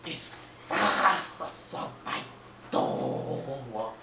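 put-on slow drunken mumble. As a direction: slur it slowly, half-whispered. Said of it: "Ele tá bebo".

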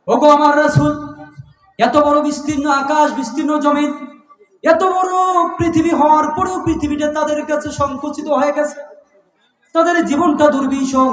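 high, ringing sermon chant; full-force preaching. Acted. Preacher voicing God's address to His Messenger, high impassioned proclamation. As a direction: plead it out on a high sustained pitch.

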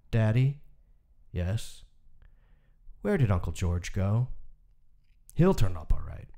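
measured, somber reading of a short story; story narration. A narrator's reading. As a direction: read it like an audiobook narrator, even and grave. Reads a Hemingway story aloud.

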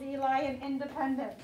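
ordinary talk, nothing marked

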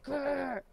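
excited little "grrr"; rolled, revved-up growl; playful growl. Pretend.

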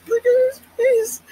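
high, loud squeal through laughter; laughing squeal. Real, not acted. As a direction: crack up at the face. Laughing at a funny face on screen.

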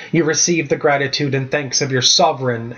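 ordinary delivery, nothing marked

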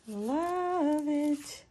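high, soft, drawn-out coo; adoring coo. Real, not acted. Crafter finding a little card, cooing adoringly. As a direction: coo it gently and long.

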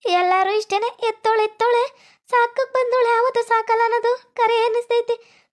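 high-pitched, indignant grumbling; aggrieved complaint. Acted. Child complains about father and aunt. (ಅಸಮಾಧಾನದ ದೂರು)

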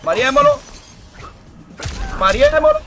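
rising hype shriek; hyped squeal. Real, not acted. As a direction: squeal it as the play lands. Repeated hype call rising.

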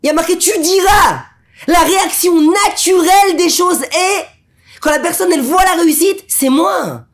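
high, loud preaching shout; fiery preaching. Acted. Preacher hammering the lesson on pride, full force. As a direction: thunder it from the pulpit.

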